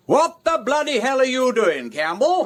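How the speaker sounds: loud, high and furious; bellowed challenge in the road; angry shout. Acted. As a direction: yell at the drunk to stop his car. Wimsey acts the murderer's quarrel.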